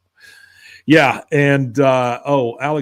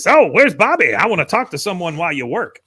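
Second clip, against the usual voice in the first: higher, louder, curt demand; mean old man; 5 semitones higher, 5 dB louder than usual. Retold or imitated. Grandpa Cotton demands Bobby rudely while Hank works, mean. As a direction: needle him coldly while he works. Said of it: "Again, very, very mean".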